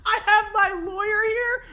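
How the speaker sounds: high, loud squeal through laughter; shrieked question, cracking up; incredulous laughing. Real, not acted. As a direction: echo it in hysterical disbelief. Repeats clip line in disbelief.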